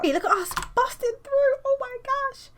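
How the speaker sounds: high-pitched giddy celebration; squeaky, hammed-up delight; mock excitement. Artist cheering a finished page, mock excited.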